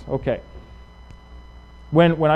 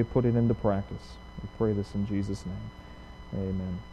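quiet, reverent prayer voice; 5 semitones lower, 5 dB quieter than usual. Real, not acted. Pastor praying quietly before the sermon, reverent. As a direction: pray softly over the congregation.

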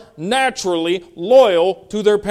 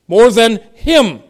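loud, high, slow, landing on "him"; emphatic HIM; 6 semitones higher, 8 dB louder, slower than usual. Shown as a demonstration. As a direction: punch "him" after a measured "more than". Repeats emphasized phrase with pronoun swapped.

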